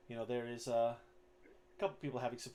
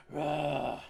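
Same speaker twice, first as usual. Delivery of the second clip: loud, raised mock crowd roar; 4 semitones higher, 8 dB louder than usual. Goblin crowd cheers the new challenger, imitated roar. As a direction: let out a long arena roar.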